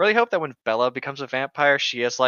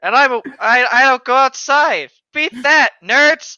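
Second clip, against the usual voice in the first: higher, louder gloating yell at the nerds; triumphant shout; 10 semitones higher, 10 dB louder than usual. Real, not acted. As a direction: crow it loudly, teasing friends. Gloating that even she knew.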